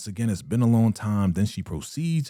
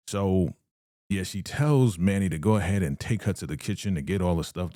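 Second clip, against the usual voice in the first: normal talking voice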